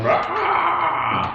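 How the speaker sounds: high snarling roar; wolf roar. Retold or imitated. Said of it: "just sitting there going".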